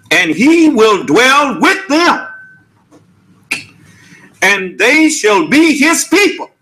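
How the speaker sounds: slow, swinging preacher's cadence, pitched high; scripture recitation. Acted. Preacher quoting scripture to the congregation, slow lilting recitation. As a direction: recite the verse in measured, rising and falling waves.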